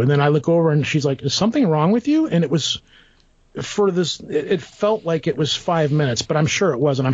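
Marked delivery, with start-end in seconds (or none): 1.2-2.3 higher, light imitation of his daughter; daughter's voice. Daughter asks her dad in the driveway, lightly voiced higher. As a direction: voice the daughter asking, concerned.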